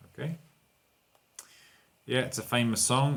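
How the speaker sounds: ordinary talk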